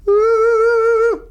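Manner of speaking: very high, loud hoot. Shown as a demonstration. Coach demos the hoot sound for students. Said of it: "try it on the hoot".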